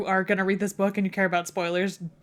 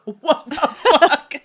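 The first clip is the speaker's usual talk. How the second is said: loud, slow, laughing disbelief; drawn-out, higher-pitched amused shock; shocked reaction; 7 semitones higher, 10 dB louder, slower than usual. Acted. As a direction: act out a stunned reader, breaking into laughter. Imagined shocked reader reaction.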